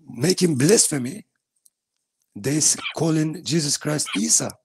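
ordinary talk, nothing marked

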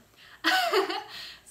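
loud high burst of laughter; real amused laugh. Real, not acted. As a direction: laugh at the dog. Dog agrees joke.

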